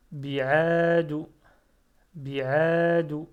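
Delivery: loud, careful model reading of Ta'ala for pupils; Ta'ala said loud and clear, each syllable full. Shown as a demonstration. Teacher reading aloud to learners, loud and careful. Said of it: "teacher models Arabic reading".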